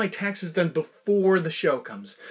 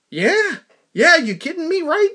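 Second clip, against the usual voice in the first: excited; 12 semitones higher, 5 dB louder than usual. Real, not acted. Thrilled about Stereolab ticket.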